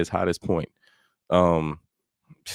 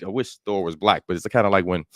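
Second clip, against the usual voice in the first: normal talking voice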